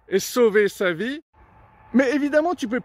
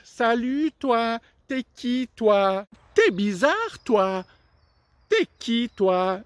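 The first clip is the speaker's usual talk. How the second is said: frog voice; 3 semitones higher, slower than usual. Acted. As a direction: poke at the odd stranger. Frog meets the crocodile.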